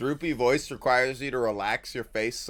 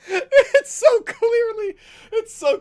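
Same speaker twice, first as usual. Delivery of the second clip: high, slow squeal through laughter; laughing hard; 7 dB louder, slower than usual. Real, not acted. Streamer cracking up at the old man's voice, laughing high. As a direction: stretch it out mid-laugh, way up.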